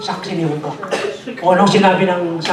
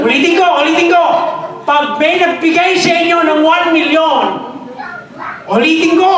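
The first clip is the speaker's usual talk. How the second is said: higher, louder preacher's shout; full-force preaching; 9 semitones higher, 5 dB louder than usual. Acted. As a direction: drive it home to the congregation.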